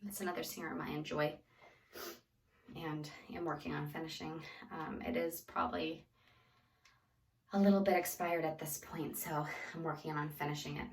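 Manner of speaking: breathless, soft and airy; out of breath while talking. Real, not acted. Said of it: "excuse my out of breath or my breathing".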